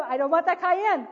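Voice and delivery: very loud, high imitated refusal; mock child outburst, loud and high; voicing child's refusal. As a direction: belt it out high, as the child's thought. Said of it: "because she knew".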